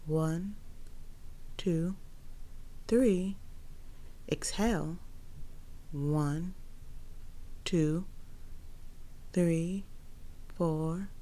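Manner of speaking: slow, soft breath counting. Acted. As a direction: count the breaths slowly and softly.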